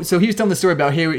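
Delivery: usual speaking voice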